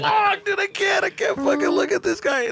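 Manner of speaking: laughing disbelief in the back seat; laughing can't look. Retold or imitated. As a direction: squeal it out helplessly amused. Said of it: "just like".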